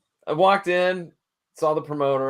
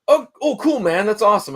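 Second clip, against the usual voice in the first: much higher, bright burst of enthusiasm; mock excited friend; 8 semitones higher, 4 dB louder than usual. Retelling his friend's thrilled reply on the phone, imitated excitement. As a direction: gush like an easily thrilled buddy.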